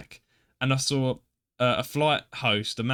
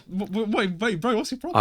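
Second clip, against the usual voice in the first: confused passenger; 8 semitones higher than usual. Retold or imitated. Imagined confused passenger reacts.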